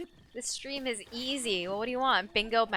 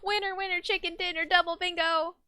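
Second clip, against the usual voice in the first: higher sing-song victory chant; excited cheer; 7 semitones higher than usual. Real, not acted. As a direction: repeat the celebration, still bubbly. Celebrating double bingo.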